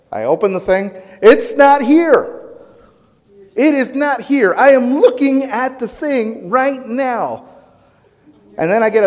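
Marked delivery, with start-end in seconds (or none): 1.2-7.4 high, slow, exasperated "it's not here"; frustrated searching. Retold or imitated. Husband hunting for salt in the cabinet, exasperated and high. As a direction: disbelief at the empty cabinet, pitched up.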